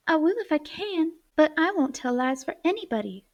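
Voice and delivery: put-on a girl firmly standing her ground, excited; excited cry. A narrator's reading. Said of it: "cried Polly, getting excited".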